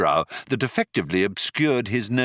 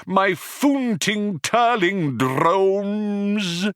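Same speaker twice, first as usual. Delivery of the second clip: very high, slow, impassioned alien declaiming; merciless Vogon; 12 semitones higher, much slower than usual. Acted. The Vogon declaims his poem at his prisoners, slow and soaring. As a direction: stretch every word in grand torment. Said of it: "continued the merciless Vogon".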